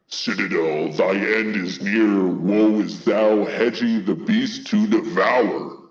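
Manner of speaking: slow, booming doom proclamation; drawn-out menacing prophecy; scary voice. Acted. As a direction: pronounce doom slowly and heavily. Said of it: "you want a scary voice".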